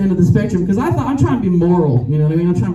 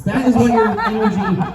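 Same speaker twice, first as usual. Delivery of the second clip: ordinary delivery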